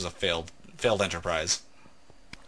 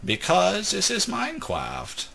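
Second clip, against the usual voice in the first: high, slow, drawn-out mock clergyman; exaggerated high-pitched wedding sermon; Princess Bride priest; 9 semitones higher, slower than usual. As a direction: intone it like a comically pompous priest. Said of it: "I was the priest".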